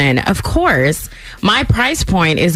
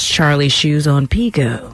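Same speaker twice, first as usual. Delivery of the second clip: lower, smooth ad-read lilt; commercial voice; 4 semitones lower than usual. Real, not acted. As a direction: slip into a light commercial voice. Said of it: "it sounds like the commercial".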